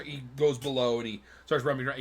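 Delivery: normal talking voice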